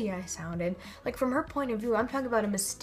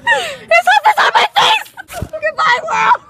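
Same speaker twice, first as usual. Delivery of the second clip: high-pitched all-out shriek; screaming in distress; 17 dB louder than usual. Real, not acted. Trampled person under a goat's hoof, high panicked scream. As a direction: scream it out like a last farewell.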